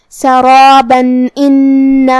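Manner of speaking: سرابن ان with the nun said out clearly, no nasal hum, drawn out slowly; clear nun of tanween. Shown as a demonstration. Teacher shows the joined recitation, slow Quranic chant. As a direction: recite سرابن ان slowly, sounding the نون plainly. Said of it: "ہم یہاں تنوین کو ظاہر کریں گے اور اسے نون ساکن پڑھیں".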